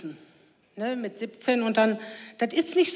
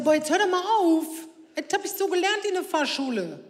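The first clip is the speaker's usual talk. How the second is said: put-on exasperated teen son; 5 semitones higher than usual. Son protests to his mother about the stop sign, higher whiny exasperation. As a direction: whine back at mom, fed up.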